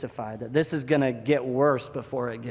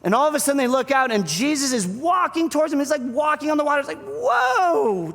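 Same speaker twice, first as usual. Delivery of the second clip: loud, high, breathless-paced storytelling; amazed excitement; 12 semitones higher, 6 dB louder than usual. Retold or imitated. Preacher retells Jesus walking on water, amazed excitement.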